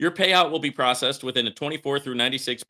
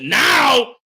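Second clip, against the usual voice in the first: much higher and louder, a burst; sudden shout; 11 semitones higher, 10 dB louder than usual. Real, not acted. Warning viewers about the stuck payouts with a sudden shout. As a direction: punch it out to grab attention. Builds to the problem.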